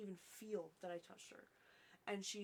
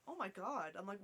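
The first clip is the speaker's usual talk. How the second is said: ordinary delivery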